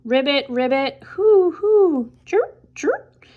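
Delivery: frog, owl, cricket calls; animal calls. A narrator's reading. Night animal chorus.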